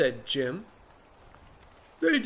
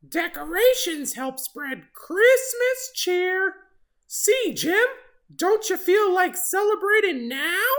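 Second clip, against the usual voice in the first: very high, slow, louder bird voice; chirpy excited bird; 4 dB louder than usual. Acted. Oxpecker gushing to Jim about Christmas, very excited. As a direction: gush like a tiny thrilled bird.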